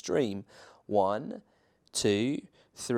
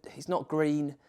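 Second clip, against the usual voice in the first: ordinary delivery, nothing marked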